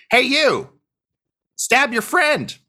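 high, forceful shouted order; commanding character holler; yelled command. Acted. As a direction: yell it high, like casting a spell. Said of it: "So he yells it".

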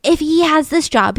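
usual speaking voice

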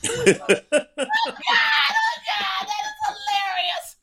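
high squealing laughter through the words; laughing hard. Real, not acted. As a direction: lose it laughing mid-sentence. Cracking up at her own story.

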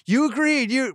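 high, exasperated protest at four in the morning; mock argument. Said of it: "we're fighting".

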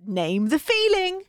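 rally yourself like a coach; hammed-up pep talk to herself; mock self pep talk. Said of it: "So I thought, again".

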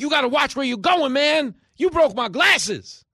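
high, loud yelling hustler; shouting street hustler; angry grifter. Acted. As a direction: bark it like a street grifter caught you. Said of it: "He's like".

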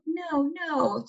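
high-pitched pretend crying; hammed-up weepy protest; mock tearful. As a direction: plead in a high, teary mock voice. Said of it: "shedding tears".

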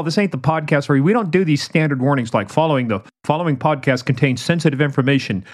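ordinary talk

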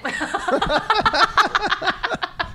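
long burst of high laughter; high cackling laugh. Real, not acted. Dad tickled by the comeback, high cackle. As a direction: crack up helplessly.

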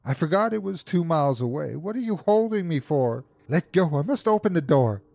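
high-pitched, struggling protest; frantic pleading. A narrator's reading. Wife struggles free to open the door, frantic pleading.

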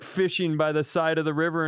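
ordinary talk, nothing marked

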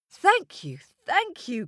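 high, excited thank you; grateful thanks. Acted. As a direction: gush your thanks. Grateful crowd thanks Coyote.

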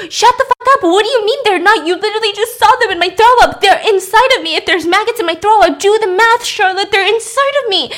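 loud, high imitated hysterical rant; frantic outburst. Lizzie arguing with Charlotte about maggots inside her, frantic outburst. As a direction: argue in a panicked near-shout.

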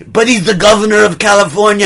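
high, loud, hurried in a put-on accent; mock-outraged and quick; heated argument. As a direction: argue loudly in a French-Austrian accent.

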